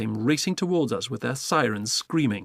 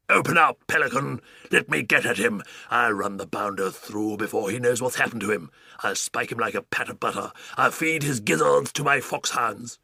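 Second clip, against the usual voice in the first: put-on blustering old Duke. Duke demanding the pelican open up, blustering. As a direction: rant like a fiery old duke.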